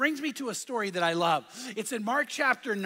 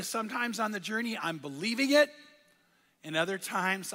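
usual speaking voice

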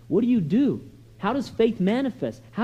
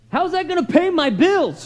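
higher-pitched, mildly indignant pushback; voiced frustration, pitched up; exasperated objection; 7 semitones higher, 3 dB louder than usual. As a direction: protest like someone with real worries. Acting out the frustrated believer's objection.

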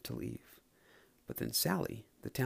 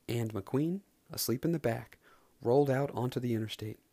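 ordinary delivery, nothing marked

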